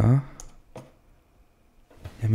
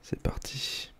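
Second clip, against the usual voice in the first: whispering; 15 dB quieter, slower than usual. Acted. Whispers before making sound effect.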